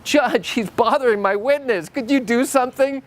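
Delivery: high, whiny plea to the judge; whining. Retold or imitated. Lawyer whining to the judge about opposing counsel, mock whine. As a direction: play the lawyer as a whiny complainer. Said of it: "It's basically a whining objection".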